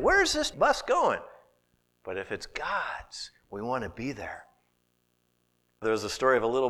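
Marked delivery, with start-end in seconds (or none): 0.0-1.2 high, louder bewildered question; confused asking. Pretend. Voicing a confused believer. 2.0-4.4 preacher whispering to the congregation; hushed whisper. Real, not acted. Hushed turn in sermon.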